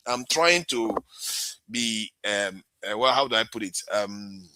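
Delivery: ordinary delivery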